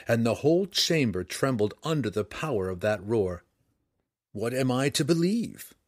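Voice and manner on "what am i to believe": ordinary delivery, nothing marked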